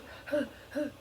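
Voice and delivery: high, sharp startled gasp; a sudden intake of breath; retold startled gasp. Dad catches him on stairs.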